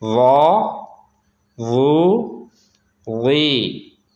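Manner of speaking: व, वो, वी with a thick consonant, long vowels; heavy letter. Shown as a demonstration. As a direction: thicken व, वो, वी, first vowel rounded. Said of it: "पुर पढ़ेंगे, मोटा पढ़ेंगे".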